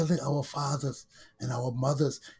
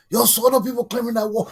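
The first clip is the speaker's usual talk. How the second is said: normal talking voice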